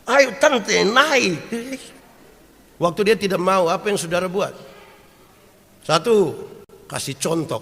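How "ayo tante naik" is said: high, loud urging; calling auntie. Retold or imitated. A nephew urging his aunt from the village onto the mall escalator, high and loud. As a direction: call her up onto the escalator.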